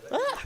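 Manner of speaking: long rising yawn mid-sentence. Real, not acted. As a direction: let out a big open yawn. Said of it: "pardon my yawn".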